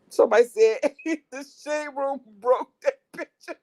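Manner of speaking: high, loud, laughing delivery; laughing while talking. Real, not acted. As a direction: get it out through real giggles. Cracking up while relaying a comment.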